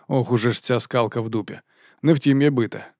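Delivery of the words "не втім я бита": ordinary delivery, nothing marked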